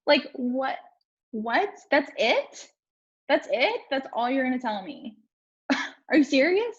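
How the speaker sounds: high-pitched exasperated what, are you serious; outraged disbelief. Retold or imitated. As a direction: replay the fury at a useless counselor. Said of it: "I was pissed".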